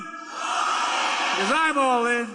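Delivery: drawn-out shout to the crowd; rally shout. Real, not acted. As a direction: stretch it out and shout it.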